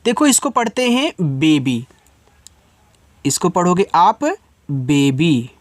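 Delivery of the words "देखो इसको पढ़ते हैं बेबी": ordinary delivery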